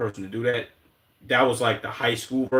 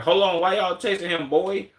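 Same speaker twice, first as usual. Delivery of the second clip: mock questioning bystander; 7 semitones higher than usual. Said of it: "They'd be like".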